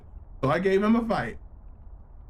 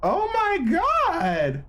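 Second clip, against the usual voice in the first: shocked; 11 semitones higher, much slower than usual. Real, not acted. Traumatic scene reaction.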